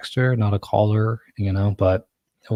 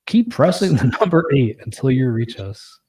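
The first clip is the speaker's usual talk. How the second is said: commercial voice; 5 semitones higher, 4 dB louder than usual. Retold or imitated. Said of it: "So they say".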